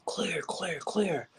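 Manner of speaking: high-pitched imitation of a medic calling out; retold medic call. Retold shout heard while fading out.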